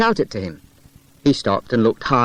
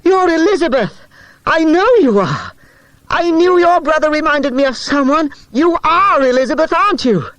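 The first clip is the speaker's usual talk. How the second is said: out of breath; 5 dB louder than usual. A narrator's reading. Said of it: "Peter was out of breath".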